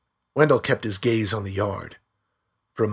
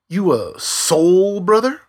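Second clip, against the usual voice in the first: mocking tease; 9 semitones higher, 7 dB louder, slower than usual. A narrator's reading. Teasing mock voice.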